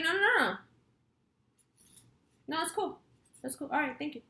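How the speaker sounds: hurried placating. Retold or imitated. Placating the rude doorman, retold.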